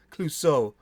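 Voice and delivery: normal talking voice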